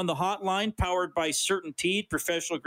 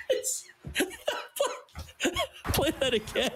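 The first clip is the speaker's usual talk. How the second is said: howling with laughter, high squeals; can't stop laughing, real; uncontrollable laughter. Real, not acted. Host hears the animal sound.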